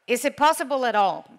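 usual speaking voice

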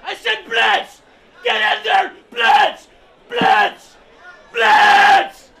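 screaming high and loud from the sideline; furious coach yell. Acted. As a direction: bellow the play call at the team.

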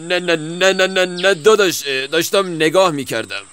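high, rushed no-no-no in a panic; flustered denial. Acted. Weasel caught spying by the fox, high flustered protest. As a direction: caught out, squeak your way out of it.

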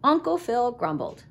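plain reading voice

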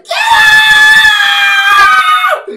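very high, loud drawn-out shriek; shrieking lady yelling him away; imitating screaming woman. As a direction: screech it at full blast. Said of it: "And she's like".

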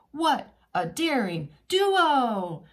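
loud slow admiring exclaim; cheering crowd. Acted. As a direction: cheer the dolphins on.

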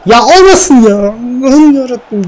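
high, desperate plea to God; praying it's not offside; anxious pleading. Real, not acted. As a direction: beg fate under your breath and up high.

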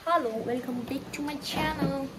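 drawn-out, very slow channel greeting; slow vlog intro. Acted. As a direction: stretch out the welcome like a host. (아주 느리게 늘인 유튜버 인사)

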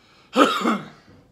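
harsh loud coughing fit; loud pained cough. Real, not acted. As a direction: cough hard from the burning. Choking on hot pepper.

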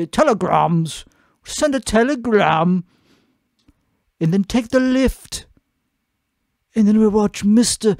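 exaggerated put-on British accent; mock British accent. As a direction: play a tea-sipping Brit, hammed up. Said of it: "I'm not that fucking guy".

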